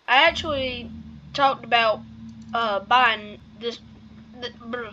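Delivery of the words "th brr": hiccup cutting off the word; breaking off with a hiccup. Real, not acted. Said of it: "sorry, I've got the hiccups".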